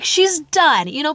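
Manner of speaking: ordinary talk, nothing marked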